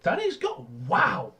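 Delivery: high-pitched stunned echo, then wow; real disbelief. Real, not acted. Co-host just hearing that Danny's gone, real disbelief. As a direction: pitch leaps up in surprise. Shocked at news about Danny.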